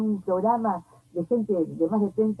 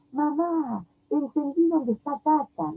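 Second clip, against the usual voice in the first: high, slow imitation of a weeping daughter; mildly voiced girl sobbing out her discovery; tearful realization; 7 semitones higher, slower than usual. Mother retelling her daughter tearfully grasping where grandpa is. Daughter crying finally understands death.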